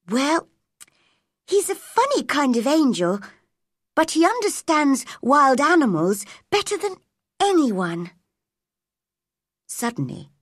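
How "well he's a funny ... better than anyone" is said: put-on higher, lively girl; lightly voiced earnest child; Mary, defending her friend. A narrator's reading. Girl explains her friend to cousin, higher and earnest.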